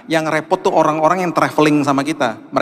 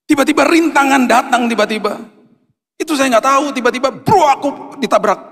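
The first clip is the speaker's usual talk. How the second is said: fiery preaching; 10 semitones higher than usual. Acted. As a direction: preach it with rising force. Preaching at full force.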